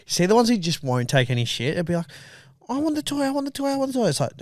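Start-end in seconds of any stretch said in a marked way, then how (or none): 2.7-4.1 mock kid's high-pitched begging; nagging child. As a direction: plead on a loop like a small child. Nagging child voiced in story.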